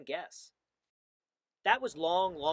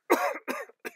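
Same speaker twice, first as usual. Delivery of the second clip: very high hacking cough; smoggy cough; 7 dB louder than usual. A narrator's reading. The Lorax chokes on smog, high cough. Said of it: "He coughed".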